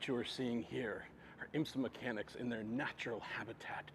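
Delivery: hushed wildlife film narrator; softly, like narrating animals in the wild; nature documentary. Pretend. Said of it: "okay, we're just kidding".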